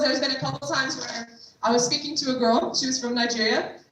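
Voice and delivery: usual speaking voice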